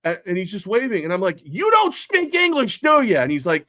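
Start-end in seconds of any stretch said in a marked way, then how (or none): 1.4-3.2 slow, much higher, condescending question; sarcastic jab. Retold or imitated. Father retelling mocking the waving driver, slow high condescension. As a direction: talk down to him, high and slow.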